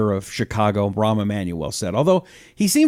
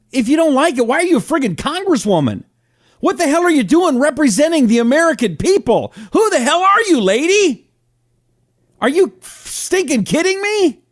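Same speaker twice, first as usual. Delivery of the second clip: angry yelling; 12 semitones higher than usual. Real, not acted. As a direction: yell at her in outrage. Host rants at congresswoman.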